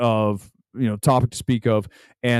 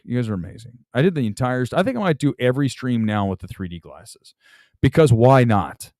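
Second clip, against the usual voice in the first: normal talking voice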